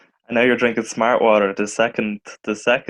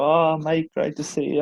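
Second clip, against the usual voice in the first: higher-pitched, mildly put-on matey greeting; feigned friendliness; 6 semitones higher than usual. Bumping into an old schoolmate in a nightclub, feigned friendliness. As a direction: act lightly pleased to see them.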